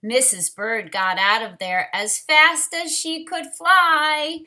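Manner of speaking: ordinary delivery, nothing marked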